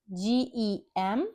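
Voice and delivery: ordinary talk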